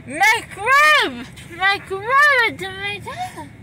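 loud, high drugged exclamation; excited. Real, not acted. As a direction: blurt it out, loopy and thrilled. Drugged outburst.

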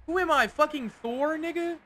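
high incredulous scoff; mocking disbelief. Real, not acted. Mocking teammate's suggestion.

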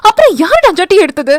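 angry snap. Acted. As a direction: hurl the accusation at him. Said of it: "என்று சீறினாள்".

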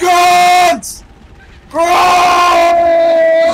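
high, drawn-out holler; playful war cry; long battle yell. Pretend. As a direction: bellow the name at length. Yelling at enemies in the game.

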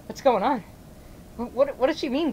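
normal talking voice

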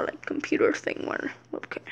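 whispered trailing aside; whispering. Real, not acted. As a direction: drop to a whisper. Trailing aside while explaining.

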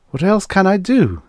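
high-pitched, exasperated plea; dismayed self-questioning. A narrator's reading. Man asking himself what choice he has, dismayed. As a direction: ask it high, falling away on DO.

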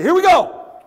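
loud, high burst of energy; hyped start. Real, not acted. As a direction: kick it off pumped up for the crowd.

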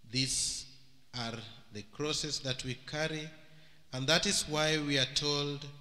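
ordinary talk

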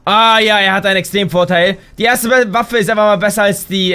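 normal talking voice

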